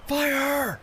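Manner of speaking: high, loud shouted order; dread-tinged shout. Acted. Captain orders the volley as the ghost ship nears, shouting. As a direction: yell the command with fear creeping in. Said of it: "Carver shouted".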